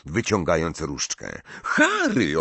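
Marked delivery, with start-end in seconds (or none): 1.6-2.4 high-pitched indignant protest; outraged girl's cry. A narrator's reading. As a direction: scold the name, pitched way up. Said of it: "oburzyła się Hermiona".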